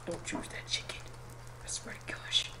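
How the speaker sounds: hushed, barely voiced warning; whispered plea. Real, not acted. Player willing someone not to choose the chicken, whispering. Muttered plea while watching game.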